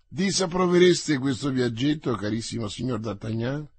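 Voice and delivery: plain reading voice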